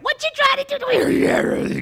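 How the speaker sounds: shrieking demon. Acted. Demon crying out at Jesus, high and shrieking. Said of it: "That's the way they sounded when they came out".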